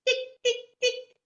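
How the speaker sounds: high, sharp little ticks; three quick high ticks in imitation; imitated tapping. Storyteller imitating a stranger tapping her shoulder, sharp ticks.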